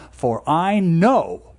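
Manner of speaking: "know" drawn out and punched; emphatic I know. Shown as a demonstration. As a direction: lean hard into "For I know", every word weighed. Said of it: "In the original Hebrew, it's very emphatic".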